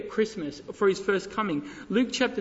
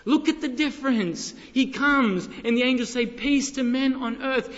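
higher, impassioned sermon voice; raised preacher's fervor; emphatic preaching; 6 semitones higher, 5 dB louder than usual. Acted. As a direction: drive the contrast home from the pulpit. Preaches the contrast forcefully.

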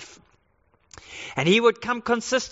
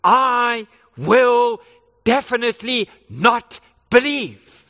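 loud, forceful declaration; emphatic; 3 semitones higher, 6 dB louder, slower than usual. Retold or imitated. Thomas insisting to the disciples, loud and emphatic. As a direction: dig your heels in on each word. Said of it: "he says emphatically".

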